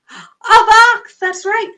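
gasping with delight; surprised gasp. Pretend. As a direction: gasp at the reveal. Gasp revealing box.

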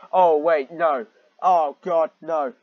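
loud, dismayed groaning no; loud appalled oh God no; horrified realisation. Real, not acted. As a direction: cry out in dread. Realising where story goes.

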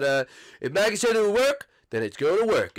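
ordinary talk, nothing marked

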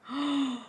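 high-pitched intake of breath; thrilled audible gasp; excited gasp. Real, not acted. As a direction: gasp in happy surprise. Excited by planner page.

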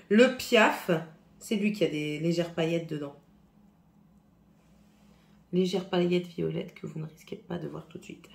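ordinary talk, nothing marked

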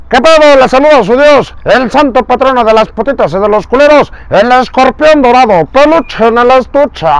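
brash show host swagger; Escorpión Dorado voice. Retold or imitated. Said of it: "la voz del escorpión dorado". (voz aguda y fanfarrona de conductor)

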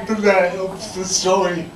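normal talking voice

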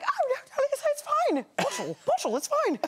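high, light British voice; a high posh British reassurance; imitating Olivia Colman. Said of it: "And she was like".

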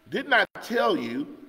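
exclaiming. A narrator's reading. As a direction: exclaim it as the king, lifting the pitch. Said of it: "the king of Israel exclaimed".

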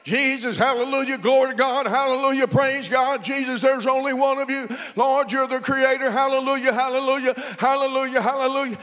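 rhythmic praise on one pitch, without a break; mock prayer-room praying. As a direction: imitate joining the nonstop prayer circle. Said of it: "I'm doing the same thing".

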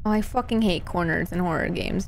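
ordinary delivery

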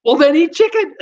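high, loud comeback; mock exasperated retort. Host answering people who compare food to chicken, mock exasperated. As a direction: throw it back in exaggerated protest. Acted retort to a common saying.

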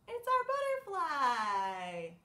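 squealed high, then a long, slow, loud butterfly; excited reveal. A narrator's reading. Storyteller announces the butterfly to the children, thrilled. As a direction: gasp out the surprise to the kids.

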